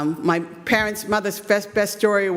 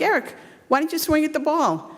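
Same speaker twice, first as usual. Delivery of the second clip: higher, exasperated mom question; 9 semitones higher than usual. Retold or imitated. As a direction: ask the son why, half amused. Mom questions son after inning.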